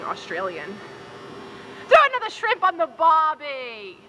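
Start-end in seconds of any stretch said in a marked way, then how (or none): 1.9-4.0 mock Aussie accent. [name] mocks Australian cliché.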